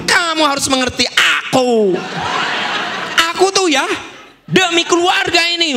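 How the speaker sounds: high, overwrought mock protest; defensive husband outburst. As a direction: plead your case like an aggrieved husband. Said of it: "Jangan gini".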